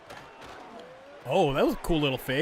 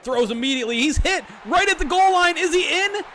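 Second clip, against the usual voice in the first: excited play-by-play; 9 semitones higher, 5 dB louder than usual. Real, not acted. Close goal-line touchdown call.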